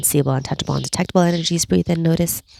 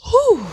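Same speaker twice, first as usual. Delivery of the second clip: loud exhale; 4 dB louder than usual. Acted.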